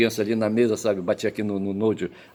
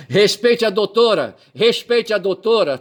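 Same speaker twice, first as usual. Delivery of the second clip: high, slow stern rebuke, louder; stern judge scolding; 9 semitones higher, 5 dB louder, slower than usual. Retold or imitated. Imitating the judge scolding him in court, stern and slow. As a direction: scold like a strict judge, word by word. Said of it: "esse juiz hoje que me deu uma mijada".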